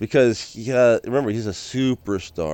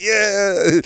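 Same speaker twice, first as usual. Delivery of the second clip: high, drawn-out mock cheer; lightly acted hyped-up yeah; 9 semitones higher than usual. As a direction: cheer back at the praise, pitched up. Replaying his hyped answer to praise.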